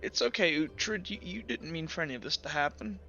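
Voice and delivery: quiet, forgiving, a touch teary; sniffling, understated. Acted. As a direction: let them off gently through mild sniffles. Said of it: "Kinda sniffles and he goes".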